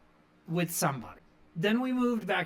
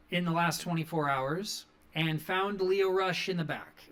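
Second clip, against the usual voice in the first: ordinary talk, nothing marked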